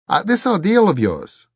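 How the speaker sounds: very high, loud, nervous query; flustered questioning. A narrator's reading. Richard questions the abbot about the ordeal, flustered. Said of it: "He was babbling".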